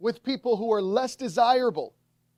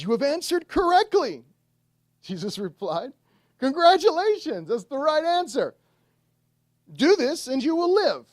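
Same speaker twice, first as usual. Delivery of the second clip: higher and louder, beaming congratulations; hearty praise; 4 semitones higher, 5 dB louder than usual. Retold or imitated. Jesus congratulating the law expert, higher and louder. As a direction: cheer him on like he won a prize.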